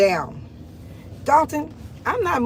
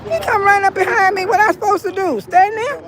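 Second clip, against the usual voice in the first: startled disbelief; 8 semitones higher than usual. Real, not acted. Woman telling viewers who crept up, high incredulous recounting. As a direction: still shaken, ask it up high. Startled by man behind her.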